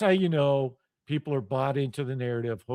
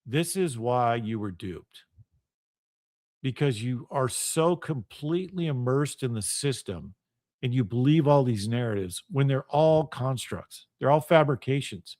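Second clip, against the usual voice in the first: low, measured explanation; very calm; 5 semitones lower, 3 dB quieter than usual. Retold or imitated. Explaining to friends why they were duped, very calmly. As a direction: bring it down to a controlled, softer register. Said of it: "very calmly said".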